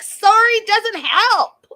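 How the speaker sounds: high, loud mocking screech; shrill mock yell. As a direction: throw it back in a shrill shout. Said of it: "And she's like".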